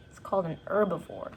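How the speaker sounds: ordinary delivery, nothing marked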